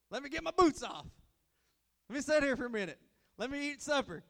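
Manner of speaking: begging for a moment of rest; exasperated plea. Retold or imitated. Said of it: "And I'm just like".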